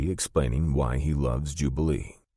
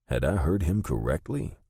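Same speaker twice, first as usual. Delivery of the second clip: ordinary delivery, nothing marked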